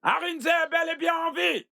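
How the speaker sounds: slow, high, ringing announcement; public proclamation. Acted. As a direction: call it out emphatically to a crowd. Announcing to the crowd.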